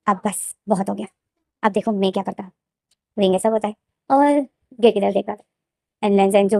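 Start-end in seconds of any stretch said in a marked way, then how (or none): 0.1-2.5 devilish inner voice. Acted. Girl silently plotting against her captor, soft devilish resolve. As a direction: quietly plot revenge, softer. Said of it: "एक devil, ... दिल में बोलता है".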